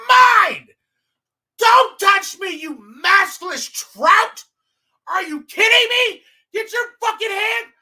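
loud, high, slow furious yelling; enraged screaming. Retold or imitated. Comic recalling screaming at the woman who slapped him, enraged. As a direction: lose it completely on her. Retelling losing his mind.

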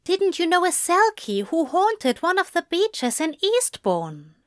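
Zira's voice. A narrator's reading. Zira voiced by narrator.

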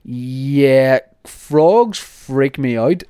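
freaked-out loud groan; loud, slow disgust. Real, not acted. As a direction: recoil out loud at frogs.

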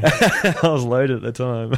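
laughing through it, slowed down. Real, not acted. Laughing to friends about being loaded on the trip. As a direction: chuckle out the words slowly.

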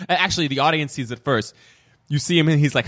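ordinary talk

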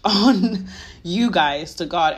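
teasing the viewers about snitching to God, laughing. Real, not acted. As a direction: giggle as you admit you'll snitch.

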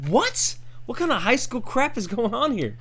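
high, loud baffled outburst; outraged disbelief. Retold or imitated. Yelling at prankster friends, high and loud. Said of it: "I go".

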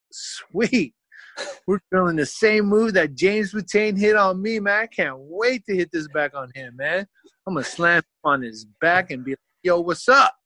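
high, excited revenge plans; bubbling over to hit the move back; eager teen. Retold or imitated. Teen at practice eager for payback, excited.

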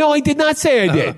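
indignant. Real, not acted. Defending himself, indignant.

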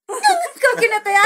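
slow, high, laughing through the words. Real, not acted. Telling friends about the hanger beating, laughing. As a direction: giggle as you try to describe it. Laughing while describing pain.

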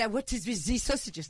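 put-on slow French accent slipping into German; bad French accent. French customer questions sausage seller, botched French accent sounding German. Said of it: "No, that's German. That's really bad".

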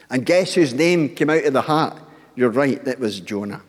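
ordinary delivery

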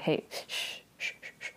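urgent whispered shushing, fading away; hushing. Retold or imitated. Soo hushing Yoru about the king, whispered shush. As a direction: shush him fast and quietly.

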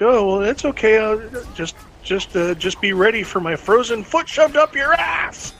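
sweet at first, then yell the threat; sarcastic threat. Retold or imitated. Sypha threatens Trevor.

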